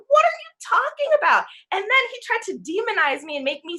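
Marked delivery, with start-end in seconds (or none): 0.1-1.4 much higher and louder, disbelieving; incredulous. Retold or imitated. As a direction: fire it back in shocked disbelief. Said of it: "I looked at him and I was like".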